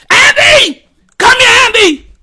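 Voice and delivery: high, loud mock yell across the house; imitating a mom yelling for her son; parent shouting. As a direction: holler like a parent calling a kid. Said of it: "And they were shouting loud".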